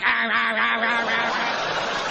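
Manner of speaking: repeated mock growling "rah"; string of cartoonish grumbling roars; angry emoticon noises. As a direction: imitate a raging emoticon. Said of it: "emoticons are like".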